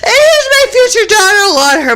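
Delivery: high-pitched storytelling through laughter; laughing hard. Real, not acted. Recalling in-laws in the back seat, laughing hard. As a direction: squeeze the words out while cracking up. Cracking up over witnesses in car.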